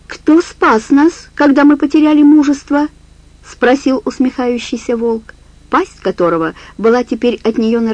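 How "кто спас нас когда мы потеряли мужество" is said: wolf faking sweetness. Acted. Wolf questions, mocking sweetness.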